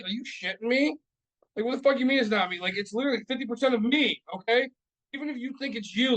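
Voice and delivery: high, incredulous retort; exasperated comeback; indignant disbelief. Retold or imitated. Retold indignant comeback to wife.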